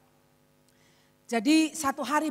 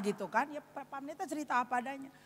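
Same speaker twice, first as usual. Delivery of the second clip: ordinary talk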